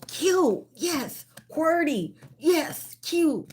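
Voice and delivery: high-pitched, giddy exclamations; giddy excitement. Real, not acted. Host celebrating the keyboard answer, giddy and high-pitched. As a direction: burst out each word high and amused.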